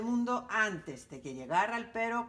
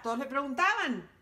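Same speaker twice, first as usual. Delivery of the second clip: usual speaking voice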